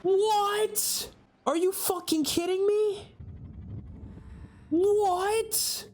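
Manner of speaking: squeaky high-pitched disbelief, voice jumping up; a real gasp of outrage at the screen; shocked disbelief. Real, not acted. Reacting to a shocking show twist.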